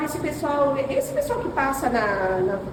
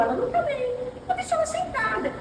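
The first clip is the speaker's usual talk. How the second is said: high, softened voice of concern; kindly stranger quoted; 10 semitones higher, 5 dB quieter than usual. Retold or imitated. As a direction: voice a considerate passenger, pitched well up. Voiced kind passenger offering seat. (voz aguda de quem se compadece)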